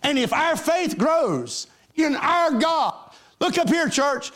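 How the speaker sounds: high, driving preacher's climax; preaching at full force. Acted. Preacher rousing his congregation, full force. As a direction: build it up for the church.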